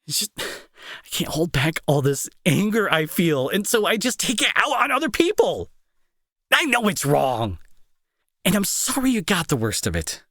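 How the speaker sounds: pushing past anger into apology, pitch breaking upward; anguished outburst. Acted. Confessing his anger to the friend he hurt, rising anguish.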